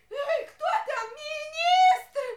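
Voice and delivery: визгливо, сквозь плач, зовёт на помощь; кричит в ужасе и рыданиях; плачущий крик. Acted. Царицу порют, зовёт на помощь. (царица в слезах зовёт министра)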